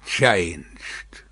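one dark low word; low grim reply. Acted. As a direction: drop it low and heavy. Defarge answers darkly.

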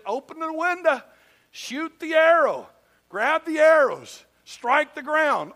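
commanding. Retold or imitated. The prophet instructs the young king step by step, commanding. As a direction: give each order slowly and firmly.

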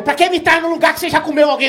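high, loud jealous shout; angry accusation. Retold or imitated. Girlfriend accusing him at dinner, loud high shout.

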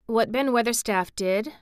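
usual speaking voice

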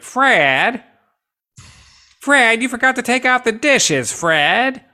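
put-on high nagging old woman. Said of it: "like an old woman voice".

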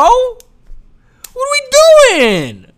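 high, very slow, loud outraged cry; exasperated wail. Acted. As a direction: stretch it out in disbelief. Exasperated rant climax.

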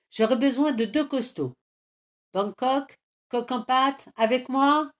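rally the strong ones, rising; rallying call. Acted. Leader picks his helpers, calling out boldly.

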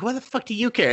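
high-pitched mock outrage; retold indignation.

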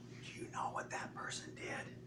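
hushed, fully whispered insinuation; devil's whisper. Acted. Devil tempting a listener to gossip, sly whisper. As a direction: whisper it like a secret planted in someone's ear. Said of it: "does the devil whisper in your ear".

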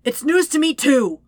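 loud, high exasperated retort; frantic, frustrated shout; shouting back. A narrator's reading. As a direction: yell it back mid-chase. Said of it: "Zuko shouts back".

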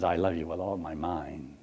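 low, soft mock endearment. Shown as a demonstration. A man to a woman, low and mock-tender. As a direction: say it tenderly yet absurdly.

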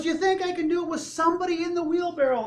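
high, loud, fast question; showman's challenge. Acted. Tightrope walker daring his crowd, excited challenge. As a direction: build the crowd up with a rapid dare. Acts Blondin rallying the crowd.

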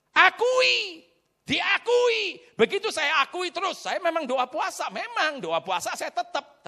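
loud, high-pitched preaching; forceful high sermon delivery. Real, not acted. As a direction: drive it home to the congregation at full voice.

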